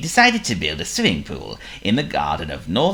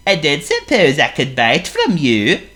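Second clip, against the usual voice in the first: Honk the seal; 9 semitones higher, 6 dB louder than usual. Acted. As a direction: play a polite silly seal. Honk's voice.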